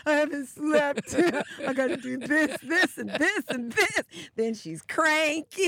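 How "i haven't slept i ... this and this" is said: high, loud, slow tired whine; hammed-up exhausted complaining; mock whining. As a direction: moan about being too tired to go. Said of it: "this is the side that I hear".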